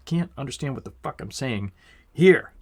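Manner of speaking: quick angry mutter bursting into a shout; mock outburst of frustration, building suddenly; anger taking over. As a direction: rush the line, then explode loud and high on the last word. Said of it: "like anger takes over".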